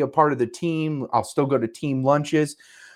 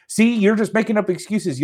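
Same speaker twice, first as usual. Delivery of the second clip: normal talking voice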